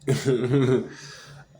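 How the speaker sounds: a loud burst of laughter. Real, not acted. Laughing while retelling the fight, loud burst. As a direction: cracks up remembering it.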